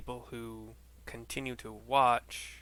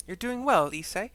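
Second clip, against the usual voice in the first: much higher, louder bright woman; young woman voice; 9 semitones higher, 6 dB louder than usual. Acted. Alisaie asking Issei about armor, high bright voice. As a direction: lift the voice up high and eager.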